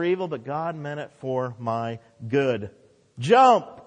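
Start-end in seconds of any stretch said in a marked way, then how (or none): 3.2-3.9 loud, high one-word command; God commanding. Retold or imitated. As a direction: call out the order as God. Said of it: "God says".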